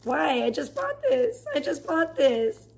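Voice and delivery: dragged-out high pitiful wail; mock sad whine. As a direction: whine it high and slow like a heartbroken kid. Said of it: "And I was so sad because I was like".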